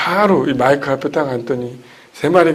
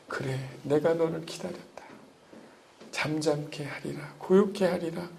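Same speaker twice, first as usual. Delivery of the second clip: gentle divine voice; 9 dB quieter than usual. Acted. Voicing the Lord's answer.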